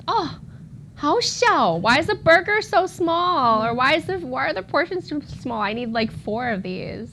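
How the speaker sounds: high, loud surprised complaint; American tourist. Retold or imitated. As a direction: play a baffled American tourist griping about tiny portions. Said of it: "an American coming here probably would see".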